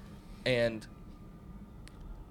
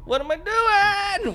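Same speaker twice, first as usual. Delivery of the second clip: very high, loud, slow mock whine; 10 dB louder, much slower than usual. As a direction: squeal it out mockingly. Mocking the victim's question.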